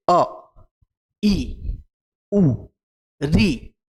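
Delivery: "अ, ई, उ, री" each cut short with a pause after; short vowels. Shown as a demonstration. Teacher showing one-beat vowels, "अ, ई, उ, री" said short. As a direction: say "अ, ई, उ, री" as brief separate vowels. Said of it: "बोलने में कम समय लगता है".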